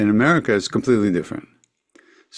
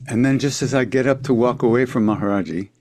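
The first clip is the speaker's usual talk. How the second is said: ordinary talk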